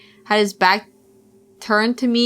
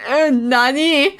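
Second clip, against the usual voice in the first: mock confusion; 4 semitones higher, slower than usual. Real, not acted. Echoing chat.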